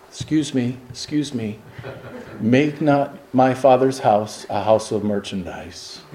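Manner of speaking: soft, meek and apologetic; mock-timid Jesus, barely audible; timid polite whisper. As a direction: ask them to leave ever so politely. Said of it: "appropriate times to raise your voice".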